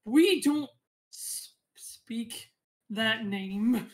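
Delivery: grim refusal. Acted. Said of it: "He's like".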